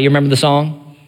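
ordinary talk

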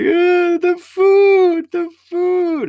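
high, loud hammed-up wail; mock crying. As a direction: wail it out like an exaggerated sobbing customer. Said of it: "hear the one start to cry".

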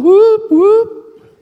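high, bright whoop of joy; jubilant whoop. Retold or imitated. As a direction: shout it out way up high. Jubilant during reaction.

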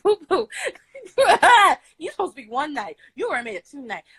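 giggling through the words; laughing. Retold or imitated. Laughing while teasing her hookup. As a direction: laugh it out playfully.

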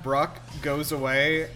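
normal talking voice

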